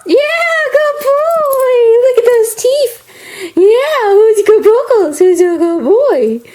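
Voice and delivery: high, gushing dog-praise voice; talking to a dog. Real, not acted.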